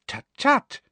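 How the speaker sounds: very high, chiding tut-tut; prim scolding. A narrator's reading. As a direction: chide the class in a high, prissy voice. Umbridge scolds the class.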